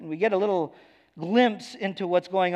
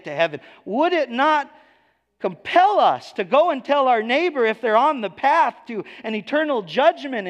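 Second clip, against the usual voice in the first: higher and louder, pressing the appeal; urgent preaching; 7 semitones higher, 6 dB louder than usual. Acted. Preacher urging the congregation to warn their neighbors, loud and urgent.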